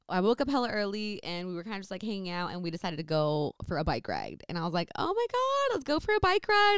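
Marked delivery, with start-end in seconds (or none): 5.0-6.8 high, bubbly burst of excitement; squealing with excitement; excited squeal. Retold or imitated. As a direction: gush about the bike ride. Excited retelling of her own suggestion.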